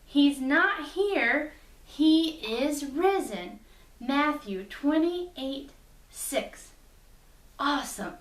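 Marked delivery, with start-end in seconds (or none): 0.1-6.7 slow, clear, word by word; slow recitation. Shown as a demonstration. Teacher repeats the memory verse with the children, slowly. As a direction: spell it out slowly for the kids to copy. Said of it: "Can we do that one more time?".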